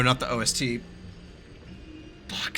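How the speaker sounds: ordinary delivery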